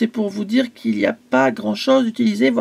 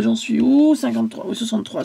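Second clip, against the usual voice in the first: usual speaking voice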